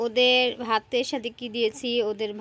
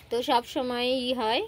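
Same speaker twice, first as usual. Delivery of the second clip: ordinary talk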